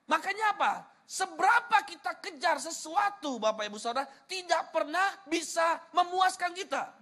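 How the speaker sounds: high, emphatic sermon delivery; impassioned preaching. Real, not acted. Preacher telling congregation what Jesus really wants, impassioned. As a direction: preach it out with punched key words.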